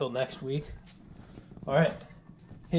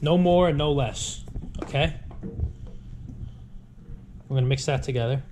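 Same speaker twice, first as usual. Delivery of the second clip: ordinary delivery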